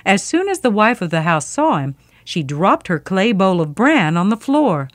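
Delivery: ordinary delivery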